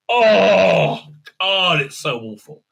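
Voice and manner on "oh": loud pained "Oh" of despair; anguished groan. Real, not acted. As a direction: let out a real groan of suffering. Groaning at how bad it was.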